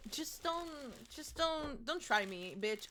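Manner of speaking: hushed playful warning; mock threat. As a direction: say it like a quiet dare.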